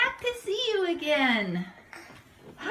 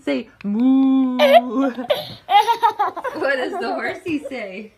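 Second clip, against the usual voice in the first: put-on toddler talk; 3 semitones lower, 7 dB louder than usual. Animal sounds game with toddler.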